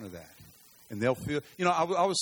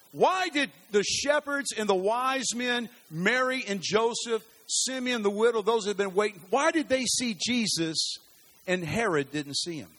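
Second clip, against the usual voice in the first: fervent preaching; 5 semitones higher, 4 dB louder than usual. Acted. Preacher rousing the church about the watchmen, fervent. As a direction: preach it out to the whole room.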